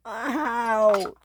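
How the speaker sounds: high, wavering drawn-out ouch; pained wail. Acted. Rosie in the wreckage, pained wail. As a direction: stretch it into a high moan of pain.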